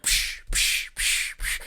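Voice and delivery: unvoiced percussive bursts; imitated scary music. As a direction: puff out sharp "psh" hits like a horror score. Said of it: "they start playing scary music".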